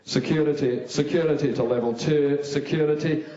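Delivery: loud radio call for security; loud, official walkie-talkie voice. Acted. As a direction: summon backup into a little mic. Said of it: "pulled out his little mic and went".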